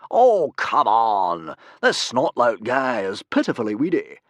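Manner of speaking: loud, slow scornful; loud snort. A narrator's reading. Humongous mocking Snotlout, loud scornful snort. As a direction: scoff at the weakling. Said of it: "snorted Humongous loudly".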